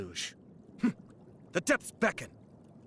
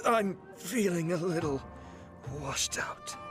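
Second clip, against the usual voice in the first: high strained start trailing away; acted collapse in defeat; weakened, spent; 6 semitones higher than usual. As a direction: fade out as strength gives way. Game character falls in defeat.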